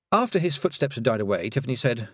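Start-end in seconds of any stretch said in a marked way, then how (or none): none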